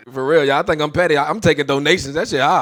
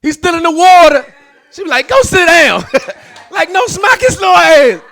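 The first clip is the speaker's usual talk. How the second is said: much higher, louder exasperated shout; exasperated yelling; 14 semitones higher, 10 dB louder than usual. Retold or imitated. Retelling kid misbehaving at pool.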